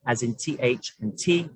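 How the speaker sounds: normal talking voice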